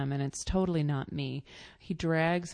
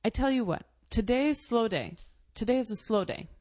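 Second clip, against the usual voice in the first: haggling shopkeeper; 6 semitones higher, faster than usual. Retold or imitated. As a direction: sell it hard like a bargaining merchant. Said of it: "he says".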